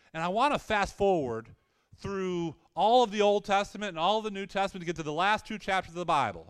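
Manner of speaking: ordinary talk, nothing marked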